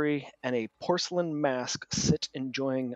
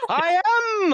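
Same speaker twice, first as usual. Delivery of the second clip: very high, loud laughing cry; giddy bard confirming proudly; laughing proclamation; 7 dB louder than usual. Acted. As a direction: shout it with a laugh. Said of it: "GM voicing NPC answer".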